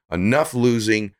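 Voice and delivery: ordinary talk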